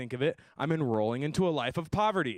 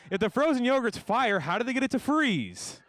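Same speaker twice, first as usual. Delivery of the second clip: higher incredulous mockery; mocking disbelief; 9 semitones higher than usual. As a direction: jeer at the dumb slang. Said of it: "I was like".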